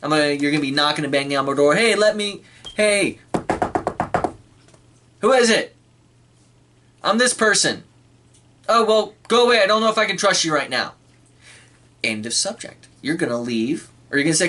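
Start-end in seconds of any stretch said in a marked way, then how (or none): 1.8-7.8 mock caller at door. Acted door-knocking exchange. 8.7-10.9 mock wary shout. Acted reply through the door.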